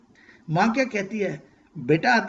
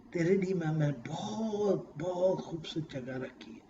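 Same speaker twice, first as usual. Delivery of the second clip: soft tender promise; 9 dB quieter than usual. Acted. God promising paradise softly.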